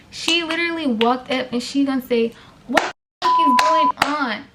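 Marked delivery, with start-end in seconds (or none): 2.7-4.4 imitating Emma. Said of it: "Like that, clapping her hands".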